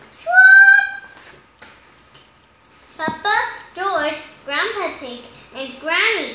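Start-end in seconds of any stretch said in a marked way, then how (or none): 0.1-1.0 very loud, high parrot screech; parrot squawk. Acted. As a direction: let out one piercing parrot cry. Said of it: "repeating what people say".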